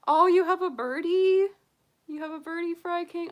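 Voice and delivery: light up at the news of a bird; delighted surprise. Real, not acted. Delighted reply to a viewer.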